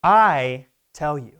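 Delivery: "I" loud and drawn out, then slow "tell you"; emphatic declaration. Retold or imitated. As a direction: declare it slowly, leaning hard on "I". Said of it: "makes a very emphatic statement".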